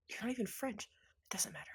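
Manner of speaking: muttered under the breath; whispered aside. Acted. Tall woman muttering aside about the French sign. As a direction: whisper it to yourself, dismissive.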